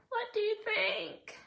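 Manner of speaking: lilting put-on voice asking the viewers; playful high voice. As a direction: sing the question up high at the viewers. Repeats question in playful voice.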